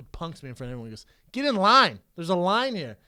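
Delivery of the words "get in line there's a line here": raised, higher-pitched order; bartender scolding. Retold or imitated. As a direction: put the customer in his place publicly. Retold bartender scolding him.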